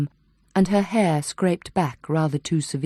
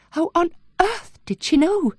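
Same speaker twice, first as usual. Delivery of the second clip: shaken disbelief; 13 semitones higher than usual. A narrator's reading. Bryony shaken after the encounter.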